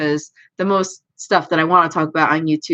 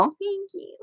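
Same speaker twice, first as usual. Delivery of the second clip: squeaky high thanks; high cutesy thank you; 13 semitones higher, 11 dB quieter than usual. Real, not acted. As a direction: chirp it up high, touched. Touched by chat compliment.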